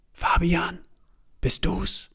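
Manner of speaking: hushed call from outside the door; whisper. A narrator's reading. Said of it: "flüstert jemand da draußen". (geflüstert von draußen)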